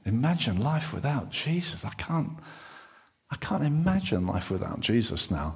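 low, soft, half-whispered relief; relieved hush. Acted. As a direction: play a shaken disciple calming down. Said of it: "That's Simon Peter talking".

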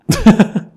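sudden high burst of laughter; big loud laugh. Real, not acted. Joke about peaches.

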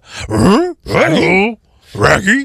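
a hint of the cartoon dog; Scooby-Doo. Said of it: "He was normally just a".

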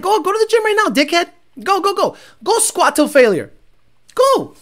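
mock yelled orders. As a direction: shout him off to squat like a drill coach. Mocking listener, telling him to squat.

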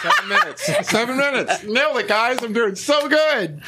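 squeaky triumphant bragging; gleeful gloating. Real, not acted. Gloating over a right answer.

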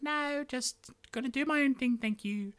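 high, dismissive princess voice; breezy refusal. Retold or imitated. Princess who has sworn off love, high breezy refusal. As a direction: brush them off in a high pitched voice. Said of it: "so that's why she's like".

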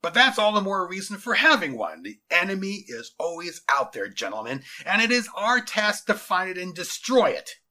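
self-important general speechifying; commanding general. A narrator's reading. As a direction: address the troops with authority. General addresses troops in story.

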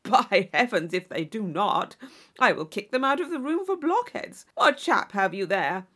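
loud, aggrieved braggart; boastful suitor complaining loudly; blustering John Thorpe. Acted. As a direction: bluster your wounded pride at her. Thorpe threatening blockheads.

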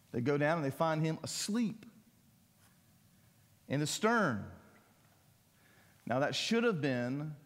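ordinary talk

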